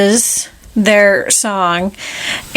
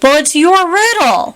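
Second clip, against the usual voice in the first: higher, put-out protest; indignant Alice; 7 semitones higher than usual. Retold or imitated. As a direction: protest innocently, a bit high. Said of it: "she's like".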